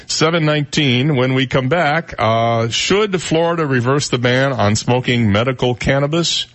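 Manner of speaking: lightly lifted host read into the break; radio tease. Acted. Host teases listeners before the break, upbeat radio read.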